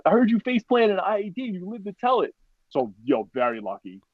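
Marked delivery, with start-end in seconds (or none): 0.0-2.3 very high-pitched mock hype; imitated celebrating. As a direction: play excited buddies congratulating a survivor. Buddies celebrate his survival.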